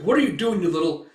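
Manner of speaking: high, loud outraged question; acted huffy protest; indignant scolding. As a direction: snap back after being hit. Hurt elf scolds.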